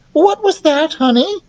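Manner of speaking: high, sweet questioning queen voice; doting queen. Acted. Queen asks her muffled son, doting. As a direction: ask in a high motherly voice.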